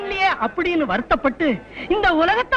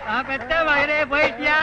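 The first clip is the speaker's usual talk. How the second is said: mock mourning wail. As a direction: wail theatrically for a dead son. Fake mourners wail over supposed corpse.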